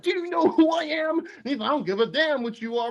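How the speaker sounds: high, pushy mock confrontation; aggressive. John Walker pinning the German guy, retold as a high, aggressive challenge. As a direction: act out the soldier shoving him into the wall, voice pushed up high. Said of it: "he gets really aggressive".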